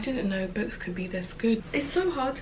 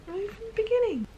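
"I knew from the beginning" in a much higher squeaky voice; squeaky high repeat; 13 semitones higher than usual. Real, not acted.

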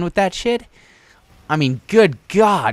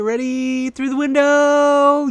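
drawn-out high suspenseful build; building anticipation; 3 dB louder, slower than usual. Real, not acted. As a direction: stretch it higher and louder toward impact. Calling the shot as it flies.

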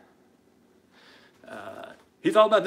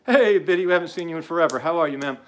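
louder, higher cheery hello; hearty greeting; 4 semitones higher, 5 dB louder, faster than usual. Real, not acted. Host breaks off to greet Biddy, hearty greeting. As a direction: call out a big welcome to a friend. Greeting a friend who joined.

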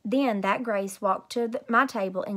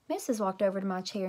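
plain reading voice

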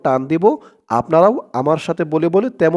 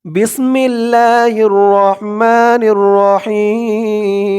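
very slow, drawn-out chant; slow recitation; 4 semitones higher, 3 dB louder, much slower than usual. Shown as a demonstration. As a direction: intone each word slowly. Recites the basmala slowly.

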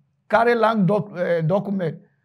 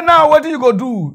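loud preacher; 5 semitones higher, 7 dB louder than usual. Retold or imitated. Pastor demanding a seed.

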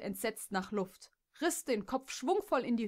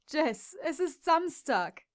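slow, higher teasing male voice; amused Clive; 7 semitones higher, 5 dB louder, slower than usual. Acted. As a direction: spell it out slowly, enjoying her mistake. Clive teases Jess. (Clive erklärt Jess amüsiert, dass Samstag ist, langsam)

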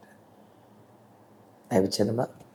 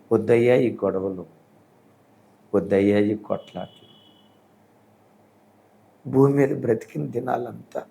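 closing prayer; 2 semitones lower, 4 dB louder than usual. Acted. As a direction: pray over the congregation, pleading for peace. (సంఘం కోసం దేవునికి ప్రార్థన చేసే బోధకుడు, భక్తితో)

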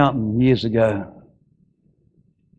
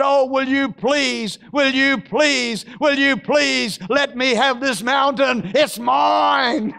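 pleading plea; 13 semitones higher, much slower than usual. Acted. Caleb pleading for the mountain.

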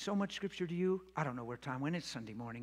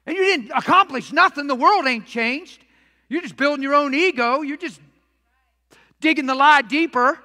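loud, raised preacher's delivery; full-force preaching; 7 semitones higher, 14 dB louder than usual. Real, not acted. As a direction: drive it home to the congregation, loud and high.

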